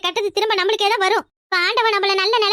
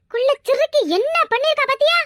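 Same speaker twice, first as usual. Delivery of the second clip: higher pitched scornful accusation; shrill accusation; 6 semitones higher than usual. Acted. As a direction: jab at her, calling on the sister. Acted quarrel, accusing sister. (உயர்ந்த குரலில் ஏளனமான குற்றச்சாட்டு)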